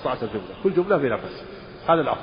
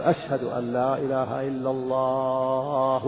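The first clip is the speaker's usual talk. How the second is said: drawn-out call; much slower than usual. Shown as a demonstration. Scholar showing students a muezzin overstretching the second line, slow and drawn out. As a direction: stretch every syllable of أشهد أن لا إله إلا الله slowly like a long call to prayer. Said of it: "ويطيل الثانية أطول من الأولى".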